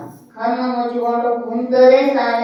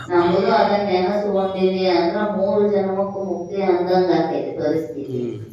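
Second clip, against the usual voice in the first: normal talking voice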